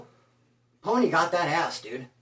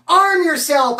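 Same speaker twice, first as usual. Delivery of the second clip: urgent; 8 semitones higher, 7 dB louder, slower than usual. Retold or imitated. Urging friend to fight.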